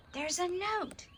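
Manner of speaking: high excited squeal. A narrator's reading. Stella squealing at the found note. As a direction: squeal it out, thrilled. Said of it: "squealed Stella".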